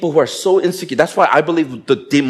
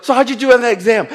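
fishing question; 7 semitones higher, faster than usual. Retold or imitated. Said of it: "they'll be like".

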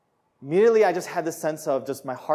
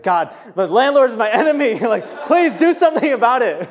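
high, loud, laughing plea; laughing mock prayer; 9 semitones higher, 10 dB louder than usual. Preacher jokes to God about the landlord, laughing loudly. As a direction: joke that the landlord is the enemy, laughing.

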